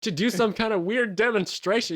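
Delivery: high-pitched mock exasperation; insistent. Doctor contradicting forgetful Craig, insistent.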